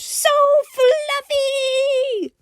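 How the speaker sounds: very high drawn-out squeal; excited shout. A narrator's reading. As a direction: squeal with delight, stretching the word. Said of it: "she shouted".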